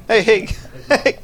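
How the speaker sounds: high squealing laughing protest; being tickled. Real, not acted. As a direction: squirm and laugh while tickled. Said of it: "your voodoo doll's ticklish".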